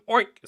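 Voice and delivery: high, loud oinking grunt; hog's oink. Retold or imitated. Hog joining the chorus, high loud oink. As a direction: oink like a pig. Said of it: "said the hog".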